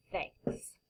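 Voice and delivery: soft breathy whisper; whispered thanks. Acted. Final refrain whispered.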